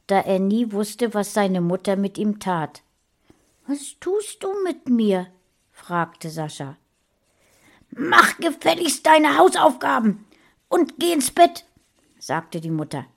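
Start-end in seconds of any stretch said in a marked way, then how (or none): 3.7-5.3 put-on high, breathless child's whisper; boy whispering fearfully to his mother; frightened whisper. 7.9-11.7 high, loud, harsh mother's command; mother snapping at her son to do homework; angry scolding. Acted.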